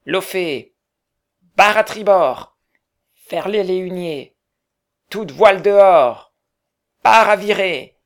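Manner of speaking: loud, high ship commands; shouted captain's orders. Acted. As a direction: bellow orders across the deck. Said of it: "donnant ses ordres".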